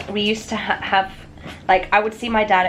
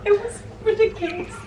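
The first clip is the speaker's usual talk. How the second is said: high, laughing and teary; laughing through tears; 11 semitones higher, 4 dB quieter than usual. Real, not acted. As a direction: giggle while welling up. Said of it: "I had a happy cry".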